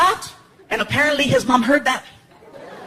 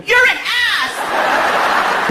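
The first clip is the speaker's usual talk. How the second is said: put-on furious girlfriend; 11 semitones higher, much slower than usual. Girlfriend phones after the prank, high outraged yell. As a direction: yell it high, drawn out on the last word.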